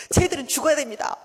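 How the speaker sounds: high, loud insistence; angry demand. Acted. Jonah demanding God destroy his enemies, angrily. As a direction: demand their punishment forcefully. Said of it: "막 요나가 그래요".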